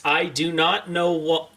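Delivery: ordinary delivery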